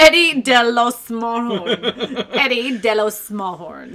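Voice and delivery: "Eddie De Los Smallhorn" drawn out slowly with relish; slow, laughing "Eddie De Los Smallhorn"; mock Spanish name.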